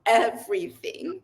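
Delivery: real emotion breaking through as she recalls it; choked-up, heartfelt recollection; getting emotional. Real, not acted. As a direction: speak close to tears, fighting to keep going. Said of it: "sorry, I'm gonna get emotional".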